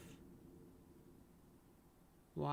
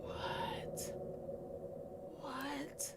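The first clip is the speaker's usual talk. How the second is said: breathless shocked whisper; stunned whisper; 10 semitones higher, 9 dB quieter than usual. Real, not acted. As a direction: whisper it in frightened disbelief. Reacting to the board's death prediction.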